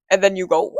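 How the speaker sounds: ordinary talk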